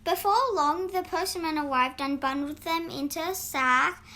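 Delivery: ordinary delivery, nothing marked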